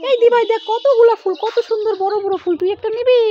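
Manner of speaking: lightly voiced little girl, sweetly coaxing; child voice. Mother playing her daughter to the brother, light child voice.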